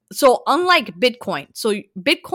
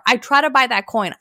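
ordinary delivery

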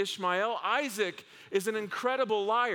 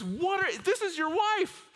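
shocked king; 10 semitones higher than usual. Retold or imitated. King discovers Isaac's wife.

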